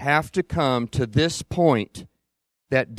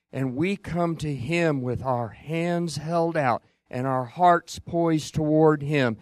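ordinary talk, nothing marked

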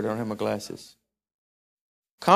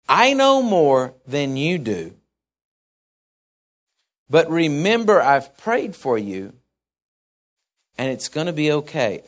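slow, lower, gentle reassurance; 3 semitones lower than usual. Retold or imitated. Jesus' reassuring look voiced.

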